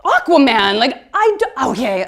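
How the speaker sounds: high, loud starstruck squeal; starstruck gasp. Retold or imitated. Shopkeeper hearing the star's name, high loud starstruck squeal. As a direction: gush at a famous name. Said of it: "And she's like".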